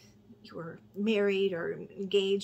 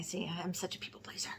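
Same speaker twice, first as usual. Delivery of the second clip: a soft, unvoiced confession to herself; hushed, self-mocking aside; whispered aside; 3 semitones lower, 7 dB quieter than usual. Real, not acted. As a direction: whisper it like a sheepish admission.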